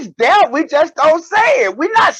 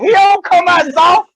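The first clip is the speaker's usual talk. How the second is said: emphatic shout; 5 semitones higher, 4 dB louder than usual. Real, not acted. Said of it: "insisting loudly".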